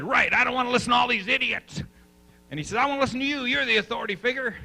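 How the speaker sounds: high, loud, fed-up outburst; exasperated doctor. Retold or imitated. Retelling a doctor's outburst to the workshop, high and loud. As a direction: blurt it out like a frustrated man at his wits' end. Doctor quoted in retold story.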